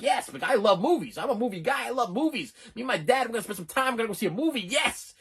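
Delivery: put-on high-pitched giddy kid recalling movie plans; re-enacted childlike excitement; super excited. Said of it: "I was, like, super excited".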